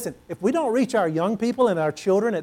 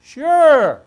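eager yes; 9 semitones higher, 12 dB louder than usual. Retold or imitated.

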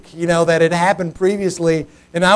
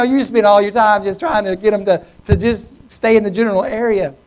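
ordinary talk, nothing marked

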